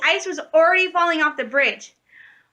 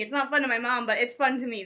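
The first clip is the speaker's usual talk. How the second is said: ordinary delivery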